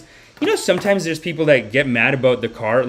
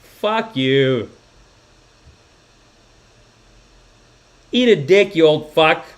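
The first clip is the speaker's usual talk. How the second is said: mock angry cursing, building; imagined outburst; 2 semitones higher, 3 dB louder, slower than usual. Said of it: "the real me wanted to be like".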